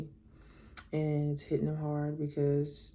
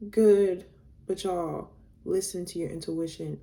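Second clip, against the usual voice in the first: ordinary talk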